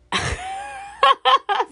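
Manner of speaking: very high-pitched laughing; amused laugh. Real, not acted. Podcaster joking about being in the streets, high laughing. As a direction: crack up at your own joke.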